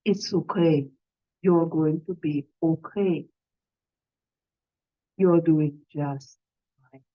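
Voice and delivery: gentle low reassurance, unhurried; supportive, reassuring tone. Shown as a demonstration. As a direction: speak to yourself like a kind nurse. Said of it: "Pick up your best supportive, friendly, reassuring tonality".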